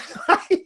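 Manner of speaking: short breathy laugh up high; high airy giggle. Real, not acted. Vlogger giggling at Trump's behavior, high airy laugh. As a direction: giggle at the news.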